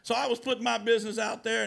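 normal talking voice

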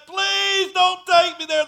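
high, loud, slow begging cry; desperate pleading cry. Retold or imitated. Dying mother begs not to be taken through the gate, crying out in terror. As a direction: beg not to be taken, terrified. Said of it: "She said".

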